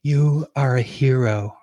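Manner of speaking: slow, overblown inspirational ad read; mock commercial voice. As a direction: ham up the heartfelt ad voice. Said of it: "they'll be like".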